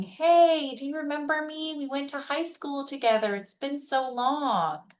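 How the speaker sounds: long-lost classmate act. Acted. Scammer messaging a supposed old high school friend, put-on friendly. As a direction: gush lightly like an old school acquaintance. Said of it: "getting that message from somebody saying".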